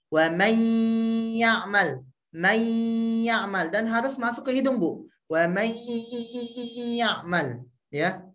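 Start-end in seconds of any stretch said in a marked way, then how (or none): 0.1-3.7 wa may ya'mal, nasal hum held three beats; nasal ghunnah held. Shown as a demonstration. Teacher demonstrates three-beat ghunnah to mother. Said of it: "Ibu harus membacanya tiga harokat". 5.3-7.6 wa may ya'mal, nasal hum held three beats; nasal ghunnah held. Shown as a demonstration. Teacher demonstrates three-beat ghunnah to mother. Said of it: "masuk ke dalam hidung".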